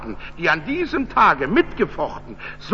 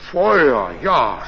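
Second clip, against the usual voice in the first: a low, matter-of-fact "Feuer, ja"; unbothered, just confirming "Feuer"; calm rider; 7 semitones lower, 4 dB louder than usual. A narrator's reading. Rider calmly confirming to the innkeeper that he wants fire, unhurried.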